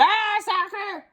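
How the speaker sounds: high, slow delighted cry; captain kicking the ball; pirate yell. Acted. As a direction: bellow with glee. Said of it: "kicked the ball and yelled".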